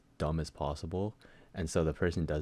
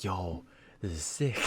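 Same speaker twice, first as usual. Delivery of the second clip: impressed parents; 2 semitones higher, slower than usual. Retold or imitated. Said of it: "and they're like".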